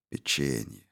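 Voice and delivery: печенье said low and soft, falling; quiet echo. A narrator's reading. Reed's inner echo of word.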